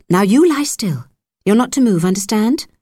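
firm nurse. Acted. Nurse orders patient to lie still.

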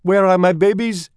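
put-on high, loud mother mouse voice with a French accent; exhausted mother. A narrator's reading. Exhausted mouse mother asks after her newborns, dramatic and high. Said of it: "said the exhausted mother".

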